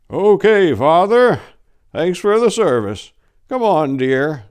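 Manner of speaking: put-on high, loud old man voice; old Harold. Old husband thanking the priest and leading his wife home, high and loud.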